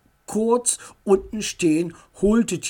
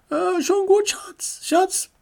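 much higher, placating voice; calm your husband down quickly; soothing wife voice; 13 semitones higher than usual. A narrator's reading. Mutter beschwichtigt ihren Mann. (hoch und beschwichtigend)

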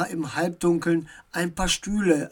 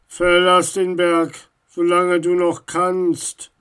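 slow, loud, menacing figure; weighty, foreboding command; ominous warning; 7 dB louder than usual. A narrator's reading. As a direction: threaten from the shadows, drawing the words out. Unknown voice from above threatens Max.

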